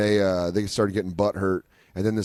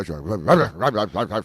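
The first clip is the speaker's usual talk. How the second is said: slow babbling bark noise; dog barking imitation; much slower than usual.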